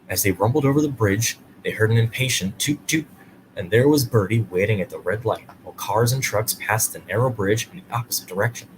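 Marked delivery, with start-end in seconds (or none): none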